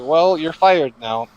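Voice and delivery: slow, loud firing line; mock boss firing. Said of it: "It's like".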